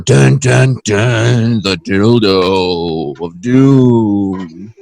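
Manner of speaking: low, very slow ominous movie-trailer voice; doom trailer voice. Acted. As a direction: drag it out like a horror trailer. Dramatic trailer announcement.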